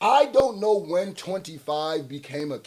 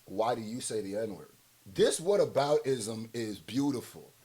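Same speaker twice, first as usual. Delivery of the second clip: ordinary talk, nothing marked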